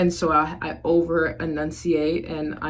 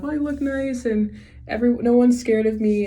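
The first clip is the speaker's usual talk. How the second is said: sweet, higher pep talk to herself; high put-on nice voice; 7 semitones higher, 5 dB louder than usual. Telling herself in the mirror, in a high sweet voice. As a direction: lift the voice up, sounding harmless and nice. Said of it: "I just tell myself".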